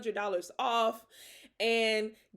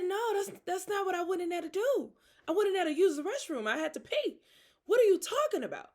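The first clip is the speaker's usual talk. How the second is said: higher, exasperated, half laughing; indignant retort; 7 semitones higher, faster than usual. Retold or imitated. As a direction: set him straight in disbelief. Retorting to the naked man.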